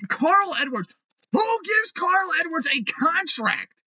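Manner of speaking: high, slow incredulous yell; outraged shout. Real, not acted. Fan outraged at the Carl Edwards signing, high slow yell. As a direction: shout it in disbelief.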